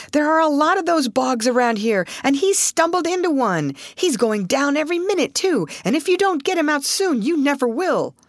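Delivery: high-pitched, insistent warning; urgent warning. A narrator's reading. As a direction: warn them that time is running out. Steamer hand warns of sinking man.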